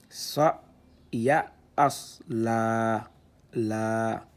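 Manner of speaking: syllabes détachées, plus fortes; lecture syllabée. Shown as a demonstration. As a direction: scande chaque syllabe. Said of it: "c'est cet alif-là qu'on utilise pour lire". (« Wa, ma, ka, sa, ba » récité syllabe par syllabe)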